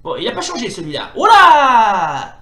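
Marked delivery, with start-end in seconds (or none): 1.1-2.4 loud, high drawn-out cry; big falling yelp of alarm; startled shout. Real, not acted. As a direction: yell at a sudden hit. Sudden hit in game.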